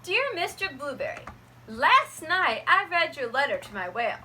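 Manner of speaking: plain reading voice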